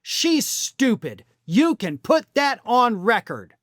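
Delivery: slow, drawn-out dismissive quote; slowly repeating the press secretary's insult; slow deliberate insult. Retold or imitated. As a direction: lay each word down slowly. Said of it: "And Levitt said".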